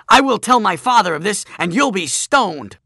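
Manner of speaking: high, loud, indignant outburst; shouted threat. Acted. Bully threatening Joshua with his father, high angry shout. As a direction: threaten them at the top of your voice.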